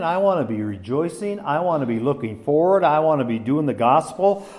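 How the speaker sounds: ordinary delivery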